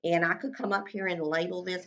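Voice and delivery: ordinary delivery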